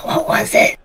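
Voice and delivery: unvoiced whisper of wonder; hushed, breathless kid's question; awed little boy. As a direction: whisper it like a fascinated child. Awed boy asks about the beast.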